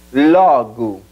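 LOGO as "LAW-goo"; open o, reduced o. Shown as a demonstration. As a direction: open the first o in LOGO, shrink the last to u. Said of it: "Abre la primera O y reduce la segunda".

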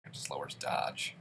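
whispered aside. Real, not acted. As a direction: breathe it under your breath. Aside under breath.

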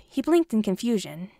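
plain reading voice

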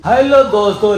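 loud, bright hello to viewers; excited greeting. Real, not acted. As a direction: greet the audience with a lift. Vlog greeting called out.

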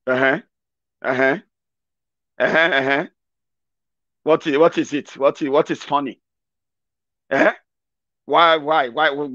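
low, mock-suspicious "uh-huh"; unamused questioning. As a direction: question the laugher in a low, unimpressed voice. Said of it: "people will just be like".

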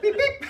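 high, piercing double beep; shrill mouth-made beep-beep; imitated walkie-talkie beep. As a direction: mimic a radio call tone in two squeaky chirps. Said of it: "this thing fucking beeps every time".

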